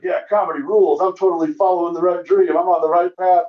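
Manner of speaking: comic gushing on the drive home after a good show; mock giddy optimism. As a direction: play the buzzing comic after a great set.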